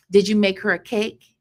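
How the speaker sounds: ordinary delivery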